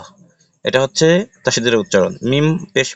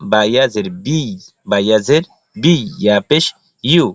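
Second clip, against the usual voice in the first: usual speaking voice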